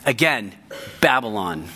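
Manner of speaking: ordinary talk, nothing marked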